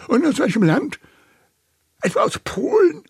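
very high, loud interrogation; indignant old uncle. A narrator's reading. Uncle Delbert asks Mary which country, high indignant uncle. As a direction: push the questions up high, full of suspicion.

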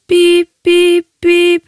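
hohes, lauteres Piepen wie ein Telefon; Besetztton nachgemacht. Retold or imitated. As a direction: das Auflegen mit der Stimme nachahmen. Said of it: "Ich hab dann aufgehängt". (Piep, piep, piep als hoher Telefonton nachgemacht)

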